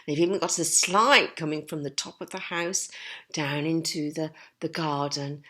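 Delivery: plain reading voice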